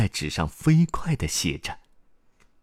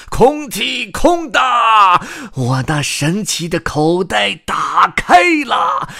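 slow, high chant rising to shouts; shouted incantation; 11 semitones higher, 7 dB louder, much slower than usual. Acted. Excited grandpa opens his story pocket, drawn-out shouted chant. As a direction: proclaim it like a showman, stretching words loudly. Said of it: "清了清嗓子喊道".

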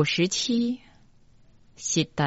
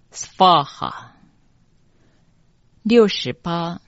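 normal talking voice

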